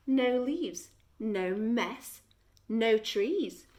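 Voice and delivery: ordinary delivery, nothing marked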